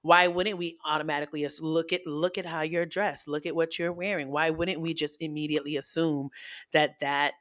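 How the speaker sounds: usual speaking voice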